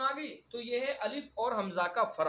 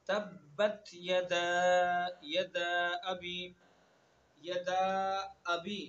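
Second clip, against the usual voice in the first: very slow, drawn-out chanted recitation; tajweed chant; Quranic recitation; much slower than usual. Acted. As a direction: recite solemnly, stretching every vowel. Recites the verse again.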